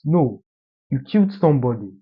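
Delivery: ordinary talk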